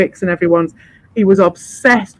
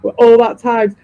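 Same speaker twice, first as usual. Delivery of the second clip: ordinary talk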